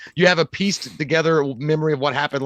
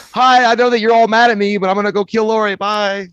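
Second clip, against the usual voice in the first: high, loud sing-out hello; mock cheery greeting; 5 semitones higher, 5 dB louder than usual. Falling man waving to angry friends, high mock-cheery call.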